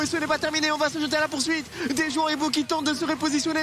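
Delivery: steady excited play-by-play; esports caster. Acted. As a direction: keep the fight call going. Hyped play-by-play casting.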